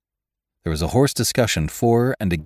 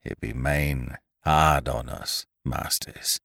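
slow, low and heavy, fading softer; 4 semitones lower, 5 dB quieter, slower than usual. A narrator's reading. Desolate Winter answers after a great sigh, slowly. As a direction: draw it out quietly, voice sinking. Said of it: "he said slowly".

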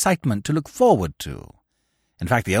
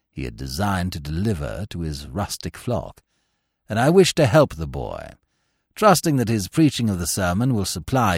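lightly voiced old vicar, lower; elderly clergyman voice; 7 semitones lower, 3 dB quieter than usual. A narrator's reading. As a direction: read the letter like an old churchman. Reading the dictated letter aloud.

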